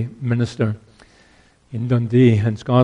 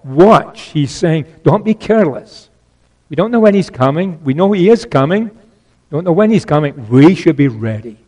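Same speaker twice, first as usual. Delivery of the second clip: forceful preaching; 3 semitones higher, 5 dB louder than usual. Acted. Preacher urging the church to watch, preaching at full force. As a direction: press the warning home to the congregation.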